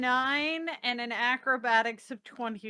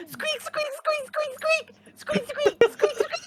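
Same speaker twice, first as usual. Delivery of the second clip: high toy squeaks, much higher, squeak squeak squeak; toy squeaker; 12 semitones higher, slower than usual. Retold or imitated. Player mocking the toy's squeaker, high squeaky toy sounds.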